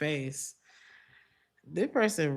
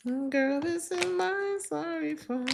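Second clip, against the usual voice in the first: a much higher, hammed-up sassy voice; mock their flimsy apology, pitched way up; 12 semitones higher than usual. Repeats mocking the other person.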